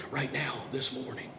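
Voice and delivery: ordinary talk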